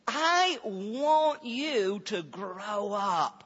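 slow, drawn-out encouragement to grow; measured pastoral appeal, each word stretched; earnest urging, not scolding. Retold or imitated. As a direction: urge them on slowly and sincerely. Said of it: "don't think of that as a scolding".

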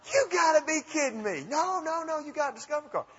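high-pitched giddy disbelief; hammed-up incredulous squeal; freaking out. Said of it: "I'm just freaking out".

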